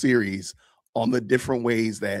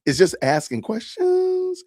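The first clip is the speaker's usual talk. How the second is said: high, slow rising question; mock upspeak; slower than usual. Shown as a demonstration. Mocking the woman's upspeak for viewers, high and slow. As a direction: lift the line up like a teen's question. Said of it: "is it called".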